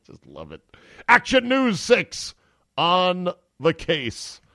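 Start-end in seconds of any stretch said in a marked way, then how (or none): 1.1-4.4 high, loud, slow announcer parody; mock news promo. Host mocking the station's hot dog coverage, slow loud promo voice. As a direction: sell the slogan like a station promo.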